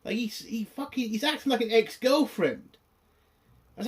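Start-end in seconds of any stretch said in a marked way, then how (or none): none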